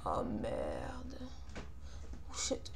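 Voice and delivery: under-breath whispered swear; whispered curse. Real, not acted. Muttered curse at game failure.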